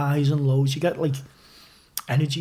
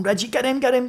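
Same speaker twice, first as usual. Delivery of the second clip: higher, urgent call to a teammate; calling out orders; 7 semitones higher than usual. Retold or imitated. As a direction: bark it from the goal, pointing. Goalkeeper calling to defender Reggie.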